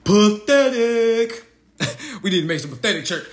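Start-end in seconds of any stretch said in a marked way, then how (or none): 0.0-1.5 slow, loud, scornful. Real, not acted. Calling the grown man pathetic, slow and loud. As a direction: stretch it out in disgust.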